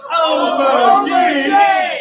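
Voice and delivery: loud shouted reply; crowd shout. Real, not acted. Crowd answering the poem's cue, loud shout. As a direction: shout it back.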